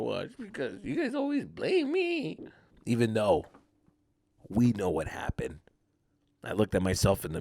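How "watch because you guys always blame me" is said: softer, high, drawn-out whimper; mock crybaby. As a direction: whimper it slowly as a sulky threat. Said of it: "I cried myself out of a situation".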